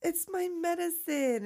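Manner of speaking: high, slow mock-gushing relief; delighted relief. Mouse getting her lost medicine back, gushing relief. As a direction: gush it high and drawn out.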